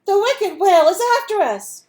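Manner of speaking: loud, high panicked warning; alarmed cry. Acted. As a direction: yell in fright that danger is coming. Fish sounds the alarm.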